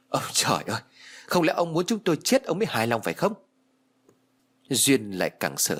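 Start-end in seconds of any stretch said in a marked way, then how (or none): 0.1-3.3 a mock wail without real volume; wailing to heaven. A narrator's reading. Mother crying out to heaven, restrained wailing. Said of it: "ngửa cổ lên trời mà gào".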